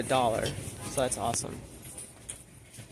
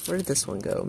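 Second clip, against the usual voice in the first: normal talking voice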